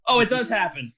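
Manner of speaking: loud, high exclamation at a lucky roll; excited surprise. Real, not acted. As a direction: pipe up loudly in triumph. Player reacts to unexpected roll.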